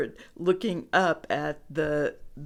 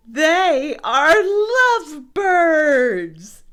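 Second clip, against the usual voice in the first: high, loud, drawn-out declaration; elated piggy singing out the news; joyful announcement; 9 semitones higher, 10 dB louder, much slower than usual. A narrator's reading. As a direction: proclaim it slowly and gleefully, way up high. Piggy happily declares lovebirds.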